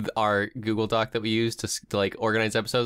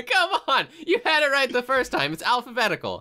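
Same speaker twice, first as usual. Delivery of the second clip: mock indignation; 13 semitones higher than usual. Mock outrage over name order.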